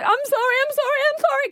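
high, flustered string of sorries; frantic apologizer. Retold or imitated. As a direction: gush apologies in a rush, pitched high. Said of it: "don't be like".

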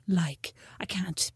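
slow whispered refusal. Retold or imitated. Imitating Mom who won't eat croissants, whispered.

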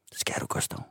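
whispered quote of the answer; hushed reply. Retold or imitated. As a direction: say it very softly, almost without voice. Crew member's reply, whispered in retelling. (hviskende, dæmpet svar)

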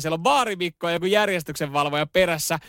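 usual speaking voice

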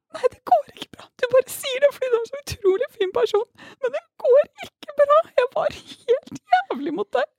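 very high, crying insistence; tearful protest. Retold or imitated. She insists she wronged him, tearful high protest. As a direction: protest through sobs. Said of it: "Jeg bare".

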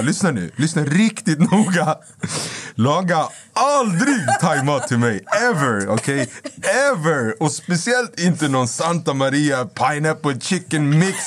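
high, slow, emphatic comic outrage; retold angry rant. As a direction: re-enact losing it, drawing out key words. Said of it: "jag blev arg".

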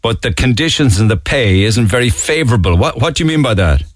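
ordinary delivery

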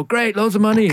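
high, loud mock enthusiasm; sarcastic. Kids reacting to dad's theatre job, loud high sarcasm. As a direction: cheer it with heavy sarcasm. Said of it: "sarcastically, obviously".

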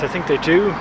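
ordinary delivery

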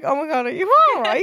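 high, light imitation of a worried boyfriend; a hint of a worried boyfriend; mock concerned boyfriend. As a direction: play his worried fuss, lightly. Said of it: "He was like".

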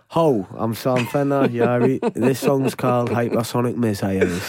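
the everyday Geordie take of the same intro; broad Geordie. Retold or imitated. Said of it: "But as opposed to".

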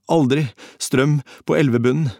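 high, disjointed rambling with gaps; delirious raving. A narrator's reading. Collapsing half-drowned man to comrade, high broken rambling. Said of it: "rablet han".

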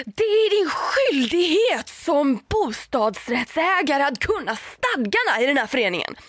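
high, slow, preachy imitation of the neighbour; mock lecturing neighbour. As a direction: spell out her duty like a stern board member. Neighbour lectures about duties.

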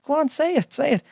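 high, quick mock urging; retold eager urging. Retelling being asked for a voice at a gig, eager urging.

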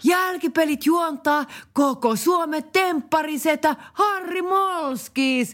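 high, slow radio ad voice; ad read. Acted. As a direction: sell it like a cheesy cruise ad. Ad read continues. (mainoslukijan ääni)

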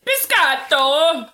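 loud, slow, drawn-out mock Italian; stretched-out cartoon Italian accent. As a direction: shout it like a hammed-up Italian. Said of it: "what other accents do you want me to do".

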